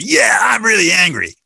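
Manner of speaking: shouted high and loud; angry admission. Acted. Angry speaker finally owning the anger, shouting. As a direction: let it burst out at last.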